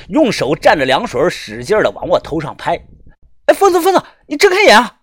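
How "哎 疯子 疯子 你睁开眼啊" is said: high, loud desperate shout; urgent calling out. A narrator's reading. Companion to collapsed friend who won't open his eyes, desperate calling. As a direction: call frantically to someone passing out.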